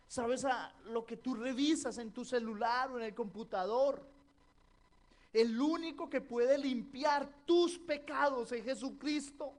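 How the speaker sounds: preaching that swells from firm to shouting; building fiery preaching. Acted. Pastor pressing the congregation about hidden sin, rising to full preaching force.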